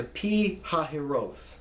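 Pi Hahiroth drawn out as "pee hah-hee-ROTH"; correct pronunciation. Shown as a demonstration. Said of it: "It's not Pi Hahiro".

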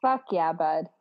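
low, easy Canadian slang delivery; Canadian accent. Real, not acted. Podcast guest agreeing with host, Canadian accent. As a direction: drop it like a Canadian buddy. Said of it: "That's the most Canadian thing".

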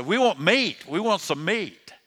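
loud, high mock griping. Preacher mimics Israelites griping for meat, loud griping. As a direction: imitate demanding whiners, pitch jumping up. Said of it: "they start complaining and griping".

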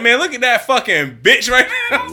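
high, loud laughing holler; excited gawking. Retold or imitated. Coworker Peter gawking.

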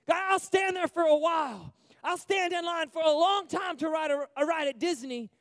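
loud, high, fired-up sermon voice; rally the congregation to go get it; full-force preaching. Acted. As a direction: passionate pulpit shout.

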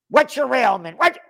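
brusque mock interrogation; gruff challenge. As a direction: demand it like a bullying security guard. Guard challenging at the fair.

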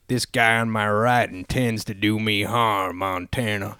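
slow, low Western drawl; Wild Bill Hickok. Retold or imitated. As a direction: imitate an Old West gunfighter's accent, quietly. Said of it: "that's a pretty good accent from him".

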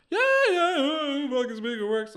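high, breezy sing-song brush-off; mock seller. Mocking the seller who swore every speaker worked, high breezy voice. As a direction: ham up a slick seller waving off the question.

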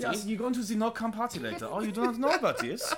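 high, mock German accent. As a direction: put on a hammy German accent. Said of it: "You have to say it in a German accent".

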